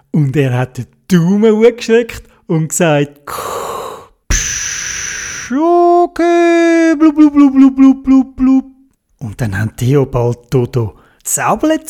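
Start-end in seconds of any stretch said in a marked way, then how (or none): 3.2-5.5 snorkel hiss. A narrator's reading. Snorkel breath sound. 5.5-8.7 underwater gurgling voice. A narrator's reading. Dodo answers underwater.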